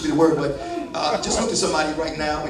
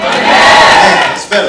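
drawn-out praise; 4 semitones higher, 13 dB louder, much slower than usual. Acted. Praising louder congregation response.

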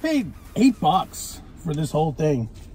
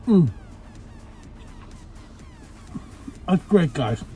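chewing while talking; muffled by food; mouth full; 6 dB quieter than usual. Real, not acted. Seen 1: Bites burger at -2; chews with full mouth until 5.0.

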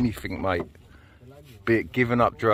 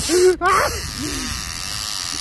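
excited laugh; 13 semitones higher than usual. Real, not acted. Fish on, laughing.

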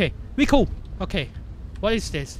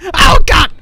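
loud, raised cry of alarm; startled yell; 4 semitones higher, 11 dB louder than usual. Real, not acted. Player startled by Santa coming close, alarmed yell. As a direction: burst out as if jumped. Jump scare in game.

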